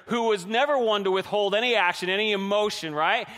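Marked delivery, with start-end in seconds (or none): none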